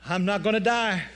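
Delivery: put-on high, loud proclamation from an old believer; loud ecstatic testimony; granny's fervent cry. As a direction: cry it out like a woman sure of the rapture. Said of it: "and she'd go".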